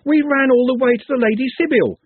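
loud, high-pitched insistence; loud, high cry; shouting. A narrator's reading. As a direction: keep shouting the news at him. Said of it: "Trev shouted".

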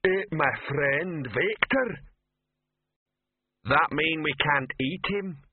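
slow bellowing troll voice; talking rock. Acted. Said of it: "Rock's voice bellowed".

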